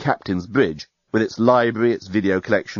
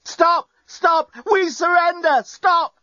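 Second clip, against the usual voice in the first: bellowing; much slower than usual. A narrator's reading. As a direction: bellow it into the microphone. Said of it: "bellowed into it".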